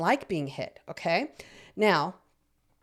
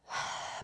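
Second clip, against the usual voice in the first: demo breath out; 15 dB quieter than usual. Shown as a demonstration. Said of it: "take three deep breaths together like this".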